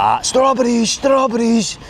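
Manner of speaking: very high, drawn-out hawker's call; imitated street seller's cry. Vlogger joking about fruit sellers from an online video, imitated street cry. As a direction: sing out your wares like a fruit seller. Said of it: "When he's like".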